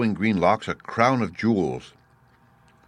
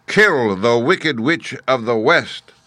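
loud, high, very slow challenge; imperious, drawn-out giant voice; booming Great Head; 4 semitones higher, 6 dB louder, slower than usual. A narrator's reading. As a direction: boom it slowly like a giant oracle. Great Head gives its order.

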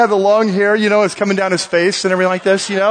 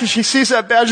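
usual speaking voice

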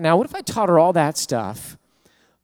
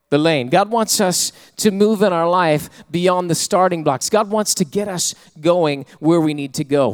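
preaching; 3 semitones higher, 5 dB louder than usual. Acted. Preaching builds.

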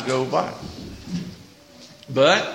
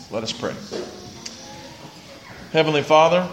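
quiet, unhurried prayer voice, soft at the ends; bow your head and pray softly for the congregation; 8 semitones lower, 4 dB quieter than usual. Acted. Pastor praying over his congregation, quiet and reverent.

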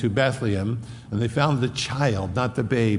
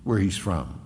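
mocking; 8 semitones higher, slower than usual. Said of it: "when Christ comes".

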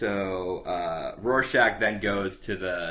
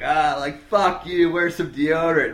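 mock bar-thug yell, pitched up and loud; 8 semitones higher, 9 dB louder than usual. Guy in a bar insulting Rorschach, mock loud yell. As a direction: hurl the insult like a rough bar regular. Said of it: "there's this guy's like".

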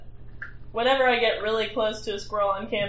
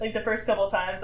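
ordinary delivery

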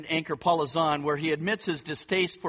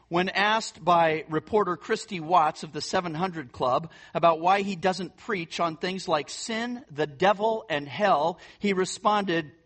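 ordinary talk, nothing marked

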